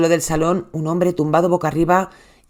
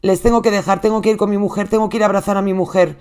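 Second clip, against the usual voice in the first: panicked plea; 7 semitones higher than usual. Retold or imitated. Husband begging the 911 operator, frantic. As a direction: voice raised, frantic and pleading.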